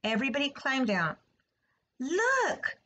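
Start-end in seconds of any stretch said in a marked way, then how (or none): 2.0-2.8 high-pitched call with a falling end; excited cry. A narrator's reading. Fern spots the new word in the web, crying out excitedly. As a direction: cry out high, as if pointing something out. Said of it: "cried Fern".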